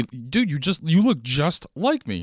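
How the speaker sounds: high, loud, drawn-out mock amazement; amazed excitement. Person greeting their doppelganger, amazed. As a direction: raise the pitch and stretch it in awe.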